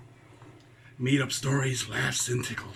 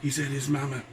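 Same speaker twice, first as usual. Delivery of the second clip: ordinary delivery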